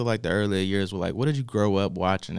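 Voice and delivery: ordinary talk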